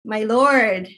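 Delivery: ordinary talk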